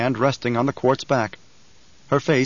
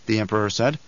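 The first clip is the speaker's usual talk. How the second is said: ordinary delivery, nothing marked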